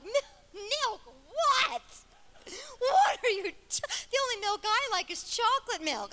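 high, loud incredulous squawk; appalled disbelief. Retold or imitated. Patient reacting to doctor's milking order, mock appalled. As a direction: balk loudly at the very idea, hammed up.

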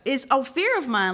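ordinary talk, nothing marked

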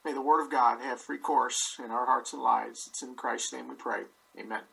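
ordinary talk, nothing marked

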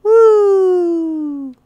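high "woo", gliding slowly down; high cheer of excitement; excited whoop. Real, not acted. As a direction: let out a long, high whoop. Excited cheer starting the fun.

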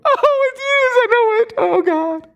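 very high, hammed-up cry of despair; mock panicked wail. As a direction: wail in exaggerated falsetto panic. Mocking a panicked believer giving in.

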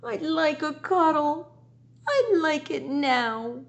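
high, pleading cat voice; a cat begging for a cuddle, slowing. Acted. Teacher reading to her class, as a high pleading cat. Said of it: "who began to meow".

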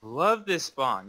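slow, drawn-out sarcasm; sarcastic. Real, not acted. Player complaining about the worst spawn to get, sarcastic. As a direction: stretch it out with false enthusiasm. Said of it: "That's sarcasm".

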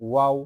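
ওয়াও sounded out with rounded lips; rounded waw. Shown as a demonstration. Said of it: "একটু খেয়াল করে দেখেন".

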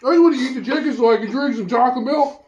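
desperate, mouth on fire; mouth burning from spicy food. Real, not acted. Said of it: "My tongue is on fire".

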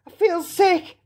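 put-on high-pitched, unwell child; queasy girl. A narrator's reading. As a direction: complain like a girl feeling sick. Violet is feeling ill.

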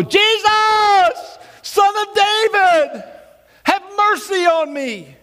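very high, loud, slow pleading cry; desperate shout. Acted. Blind beggar begging Jesus for mercy, loud high shout. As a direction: cry out with everything you have.

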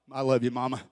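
soft, tender mock reply. Mom's sweet reply retold.

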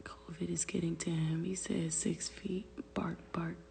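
unvoiced secretive whisper. Real, not acted. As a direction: whisper it so the dog can't hear. Secretive aside about the dog.

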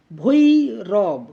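ভৈরব slow and loud in two syllables, "bhoi - rob"; syllable-by-syllable demo. Shown as a demonstration. Teacher introducing a closed-syllable example, ভৈরব split slowly and loudly. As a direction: break ভৈরব in two, dwelling on each part. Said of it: "ভৈটা এখন উচ্চারণ করছি".

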